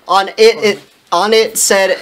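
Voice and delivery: ordinary delivery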